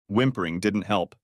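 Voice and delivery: ordinary delivery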